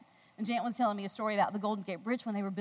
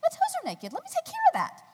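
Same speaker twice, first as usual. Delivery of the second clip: high chirpy voice; 6 dB louder than usual. Retold or imitated. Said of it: "and I'm like".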